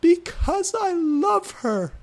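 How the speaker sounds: very high, loud, slow desperate shriek. A narrator's reading. Draco shrieks his love to the angry teachers, hammed-up scream. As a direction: screech the confession. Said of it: "Draco shrieked".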